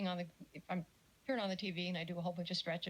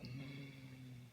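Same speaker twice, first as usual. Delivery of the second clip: tense hum; 6 semitones lower, 11 dB quieter than usual. Retold or imitated. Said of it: "It's like".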